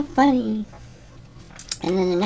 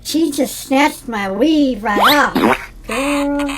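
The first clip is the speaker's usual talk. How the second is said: slow, louder, sassy; campy example sentence with sass; sassy drag demo; 5 semitones higher, 5 dB louder, much slower than usual. Shown as a demonstration. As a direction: serve it with attitude, drawn out. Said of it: "you would say".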